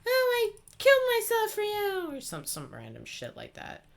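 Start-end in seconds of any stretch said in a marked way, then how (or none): 0.1-2.2 high wailing mock sob; hammed-up crying plea. Teammate whining at him, mock sobbing. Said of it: "I don't wanna listen to".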